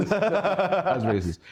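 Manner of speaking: high, laughing self-comment; laughing aside. Real, not acted. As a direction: break up laughing mid-sentence. Laughs at own avoided accent.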